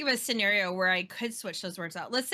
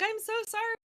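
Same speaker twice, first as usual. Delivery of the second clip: pitched way up, playfully sorry; over-apologetic; 13 semitones higher than usual. Retold or imitated. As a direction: squeak out a helpless apology. Her apologetic reply to friend.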